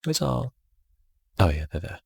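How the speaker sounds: ordinary talk, nothing marked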